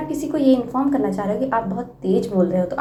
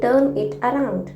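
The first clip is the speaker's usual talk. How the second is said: slow model phrase; much slower than usual. Shown as a demonstration. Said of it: "तो आप कहिए".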